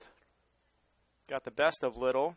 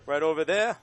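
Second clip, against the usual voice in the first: Boston accent, louder; 2 semitones higher, 5 dB louder than usual. Real, not acted. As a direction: drop the r in there, Boston style. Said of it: "Boston accent there".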